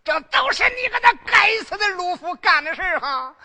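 high, loud enraged yell at the brother; loud outraged shout; furious shout. Acted. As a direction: roar out the accusation.

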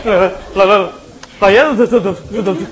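comic stammer, stuck on each syllable; stuttering. Acted. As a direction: trip over every word in a fluster. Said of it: "你这会儿怎么结巴了". (结结巴巴地说)

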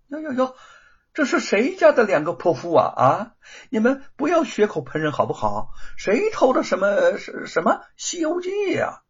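high, loud, sneering play-dumb; mocking denial. The thief denies stealing the manuscript, sneering. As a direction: brush them off with scornful mock innocence. Thief mocks her accusers.

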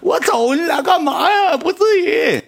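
hammed-up, very high-pitched crying girl; tearful wailing. Girl begging two fighting guys to stop, mock tearful wail. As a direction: imitate a girl wailing in a drawn-out squeal. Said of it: "那女的后期搁网吧都哭了".

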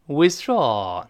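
"withdraw" with the American sigh-like ah in draw; American pronunciation. Shown as a demonstration. Teacher demonstrates the American pronunciation of the word. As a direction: say "withdraw" the American way, open ah sound. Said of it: "我们再读一下美音".

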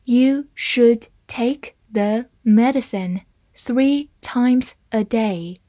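slow, careful read-through; deliberate slow model sentence. Shown as a demonstration. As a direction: read it slowly for the class. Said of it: "好，慢慢来读。".

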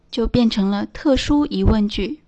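ordinary talk

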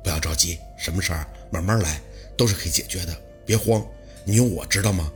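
low, soft, steady reassurance; gently firm. A narrator's reading. Lu Pei calming dazed Baosi face to face, gently firm. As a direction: lean in close and reassure, low and quiet. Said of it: "轻轻地重了下语气".

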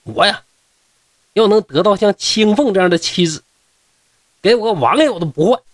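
mild shout, pitched high; loud declaration. Acted. Drunken scholar declaring his wish for a wife like Qingfeng, loud declaration. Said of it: "大声喊道".

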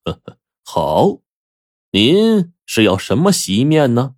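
slow, drawn-out mock courtesy; sneering politeness, taking it slowly; cold sneer. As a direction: humour him with a dry, scornful heh-heh. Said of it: "冷笑道".

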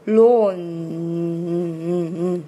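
low, drawn-out "lawn" ending in a hummed "ng"; back nasal demo. Shown as a demonstration. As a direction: hold "lawn" into a nasal hum, "嗯". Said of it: "是一个后鼻音". (“lawn”拖长后鼻音)

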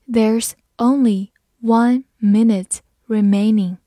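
slow and emphatic, word by word; slow read-along. Shown as a demonstration. Teacher has learners follow the example, slow read-along. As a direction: give each word its own space, firmly. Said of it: "慢慢来读".

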